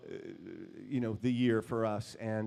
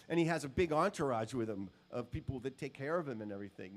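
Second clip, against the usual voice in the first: normal talking voice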